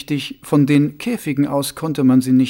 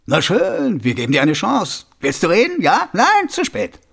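high mocking taunt, much higher; taunting guard; 5 semitones higher, 4 dB louder than usual. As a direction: tease him then snap shut.

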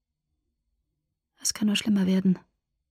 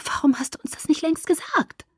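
high-pitched, light character voice; reproachful question; 9 semitones higher than usual. A narrator's reading. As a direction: ask it high, in dismayed reproach. Alice reproaches Jasper after revelation.

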